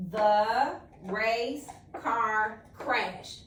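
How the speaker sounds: each word read slowly and clearly; teacher modelling a sentence slowly; slow model reading. Shown as a demonstration. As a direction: spell it out slowly for the child to copy. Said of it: "I'm gonna read it and then you read it after me".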